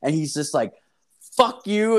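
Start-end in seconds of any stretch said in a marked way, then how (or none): 1.3-2.0 high, loud mock outburst; retold angry yell. Customer curses out the fridge sellers, high mock yell. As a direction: imitate an angry man yelling, pitched way up. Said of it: "there's this dude yelling".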